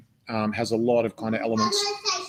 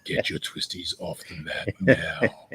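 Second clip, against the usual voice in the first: ogre voice; slower than usual. Pretend. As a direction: play the ogre, dragging out the order slowly. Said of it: "Do I need to make a ogre voice?".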